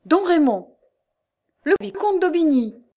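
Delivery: high, loud cries of recognition; startled cries. A narrator's reading. Two adversaries recognise each other, crying out. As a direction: exclaim in shock, voice leaping. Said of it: "Deux cris s'échappèrent à la fois".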